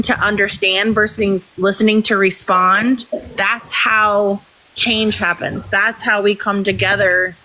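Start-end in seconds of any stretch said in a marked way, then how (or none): none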